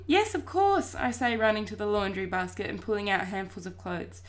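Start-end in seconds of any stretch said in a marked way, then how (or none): 0.1-1.0 eager. A narrator's reading. Boy eager to prove himself.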